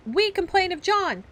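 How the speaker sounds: put-on loud, high childish shout. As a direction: yell it out together. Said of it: "cried the twins".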